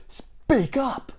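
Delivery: high, hissed demanding call; impatient shout. Acted. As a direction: order him to speak up. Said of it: "grocer demanding".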